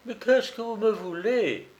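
lightly voiced frightened traveller; mild pale fright. A narrator's reading. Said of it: "demanda Dumollay, blêmissant".

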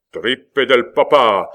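loud, slow, drawn-out bellow; roared oath. A narrator's reading. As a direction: roar it while drawing the sword. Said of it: "hurla Coconas".

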